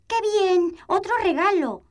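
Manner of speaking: high, loud greedy delight; snatch it with glee; cartoon princess. Princess grabbing gift eagerly.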